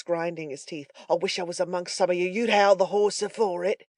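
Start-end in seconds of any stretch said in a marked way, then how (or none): 1.1-3.9 fast, then louder growl; growled threat. A narrator's reading. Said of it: "Sikes growled".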